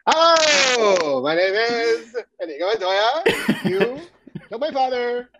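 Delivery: high, drawn-out hammy comic voice; Paul F. Tompkins. Acted. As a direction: stretch the last word out absurdly. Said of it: "Do it as Paul F. Tompkins".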